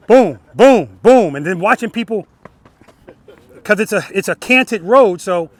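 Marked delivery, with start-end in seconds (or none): 0.1-1.3 loud punchy booms, falling each time; loud imitated booms; mock boom sounds. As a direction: thump out each boom like bikes rolling through. Imitating bikes turning one after another.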